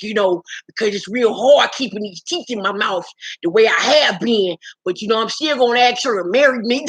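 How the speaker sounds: slurred impression of a man with loose new teeth; mock his mushy, mouthy confessional. Host voicing castmate's marriage confessional, mock slurred voice.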